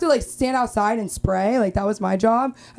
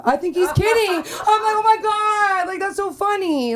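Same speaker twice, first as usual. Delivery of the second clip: high, laughing through the words; laughing giddy; 11 semitones higher than usual. Retold or imitated. Laughing at the guy asking to be called daddy. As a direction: giggle it out in disbelief.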